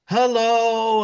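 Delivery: high mock cheerful call; sing-song hello. Other wife calling into the honeymoon room, a high sing-song hello.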